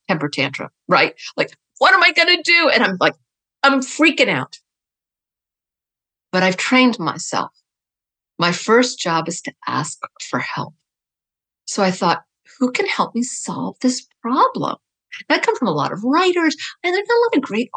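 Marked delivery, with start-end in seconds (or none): 1.8-2.7 mock tantrum. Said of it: "having a two-year-old temper tantrum". 12.6-14.8 whisper. Retold or imitated. Thinks to herself for help.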